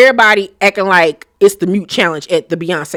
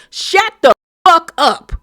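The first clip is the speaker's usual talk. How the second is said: higher pitched shouted shut up; mock yell; 8 semitones higher, slower than usual. Evelyn telling Denise to shut up, mock yell. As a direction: bark it at her like Evelyn. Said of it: "Evelyn ... was like".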